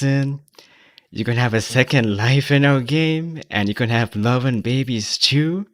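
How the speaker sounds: slow hyped-up pitchman meme voice. Acted. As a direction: sell it like a cheesy ad. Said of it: "that's my meme voice".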